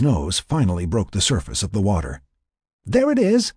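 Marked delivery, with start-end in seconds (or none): 2.8-3.5 high-pitched excited-sighting yell. A narrator's reading. Laura spots the pod surfacing, yelling. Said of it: "Laura yelled".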